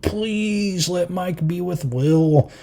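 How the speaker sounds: high, drawn-out fan plea; imitating desperate fans; mock pleading. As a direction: beg in a high, soft, stretched whine.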